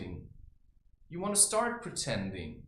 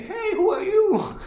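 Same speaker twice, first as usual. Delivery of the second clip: put-on much higher and louder, a bright kid's call; curious child; 13 dB louder than usual. Child greeting a stranger, high and loud. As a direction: pipe up like a fearless little kid. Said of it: "children that come and say".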